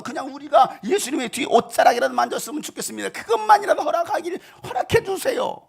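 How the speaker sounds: plea climbing very high; urgent pleading. Acted. The sick begging to touch Jesus' robe, pleading. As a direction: beg with rising urgency.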